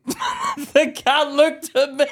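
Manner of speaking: high, very slow, squeezed through laughter; helpless laughing. Real, not acted. Telling how the cat looked next morning, cracking up. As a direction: barely get the punchline out for laughing.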